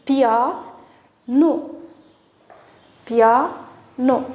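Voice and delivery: "Pia, no" as two separate beats; a clear pause between "Pia" and "no"; split into syllables. Shown as a demonstration. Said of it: "On a découpé le mot".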